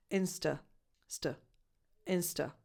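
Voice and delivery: "insta" ending in a weak "uh"; schwa second vowel. Shown as a demonstration. Teacher drilling the schwa, "insta" as "IN-stuh". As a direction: break out "st" in "insta", reduced final vowel. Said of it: "the second vowel is a schwa".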